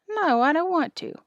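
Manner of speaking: put-on small boy digging his heels in; reluctant refusal. A narrator's reading. Louie refuses, reluctant and scared.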